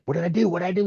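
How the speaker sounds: high-pitched, worried protest; retold alarm. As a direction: act out being caught off guard. Retold panic at being yelled at.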